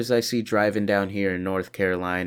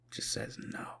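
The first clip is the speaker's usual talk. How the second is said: breathy echo whispered; 15 dB quieter than usual. Pretend. Echoes own line in whisper.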